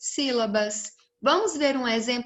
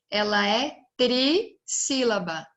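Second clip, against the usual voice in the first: ordinary talk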